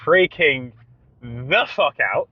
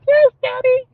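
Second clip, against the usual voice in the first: put-on high, loud little kid voice; exaggerated toddler voice, much higher; biggest child's voice; 7 dB louder than usual. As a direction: chirp it like a small child answering. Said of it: "with my biggest child's voice".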